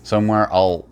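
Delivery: ordinary talk, nothing marked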